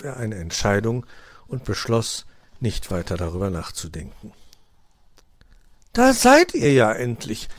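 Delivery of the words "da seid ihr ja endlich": loud, high greeting; welcoming call. A narrator's reading. Magpie hostess greets her late guests, calling out. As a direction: greet them with an exclamation. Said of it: "begrüßte sie Frau Elster".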